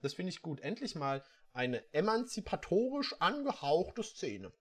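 normal talking voice

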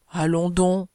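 usual speaking voice

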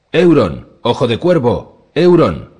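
loud, high acclaiming cry; acted shout. A narrator's reading. Oarsman hailing Euron Ojo de Cuervo, shouting. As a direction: shout each name as a rallying call. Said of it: "gritó el remero rojo".

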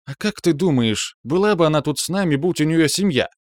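high-pitched, pointed rhetorical question; lightly reproving Лия. A narrator's reading. Лия points out Ирен's tactlessness, with mild reproof.

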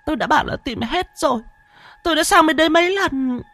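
high, fast sobbing protest. Acted. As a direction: blurt it out high through sobs. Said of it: "nức nở". (nức nở, giọng cao và nhanh)

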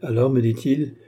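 usual speaking voice

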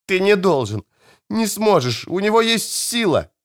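put-on high, pleading young woman's voice; wounded girl. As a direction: beg him urgently, in pain.

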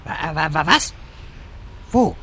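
stuttering in surprise; startled stammer. Acted. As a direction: trip over the first sound, then blurt the question. Startled by sudden idea.

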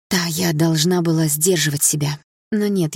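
plain reading voice

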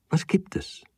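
geflüstert. Acted. Erschrockene Frage im Raum.

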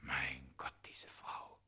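breathless stunned whisper; shocked whisper. Acted. Smith recognising the mummy's face, whispering in shock. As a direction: gasp it out under your breath.

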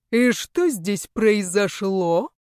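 drawling question. Acted. Official questions culprit at the scene.

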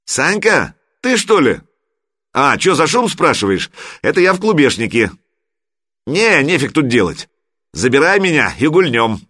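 high, lively shout over club noise; excited phone call. A narrator's reading. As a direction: pitch it up, tipsy and boisterous. Tipsy friend calling from club.

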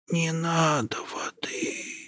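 barely audible, high, slow whisper; old woman whispering. A narrator's reading. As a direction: breathe it out, hardly there. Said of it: "еле слышно прошептала бабушка".

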